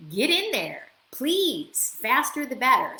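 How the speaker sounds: high, loud, eager push to go ahead; eager wife. Retold or imitated. Wife urging her husband to sign up, loud and eager. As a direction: cheer him on, the sooner the better. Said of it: "My wife's gonna be like".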